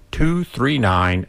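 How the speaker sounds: ordinary talk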